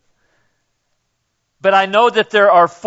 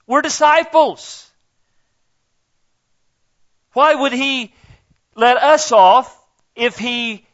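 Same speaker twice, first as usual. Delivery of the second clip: fervent preaching; 5 semitones higher than usual. Real, not acted. Preaching at high pitch.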